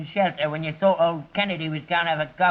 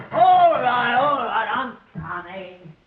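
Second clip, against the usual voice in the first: calling out; 5 semitones higher, 4 dB louder, slower than usual. Real, not acted. Calling out to someone at door.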